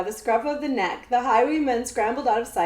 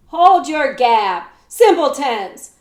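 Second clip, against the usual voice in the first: commanding shout; 8 dB louder than usual. A narrator's reading. Jemmy scolds the outlaws in a princely command, shouting. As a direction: bark it like a prince. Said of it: "Jemmy declared".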